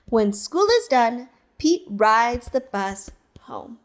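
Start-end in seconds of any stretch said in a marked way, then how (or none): none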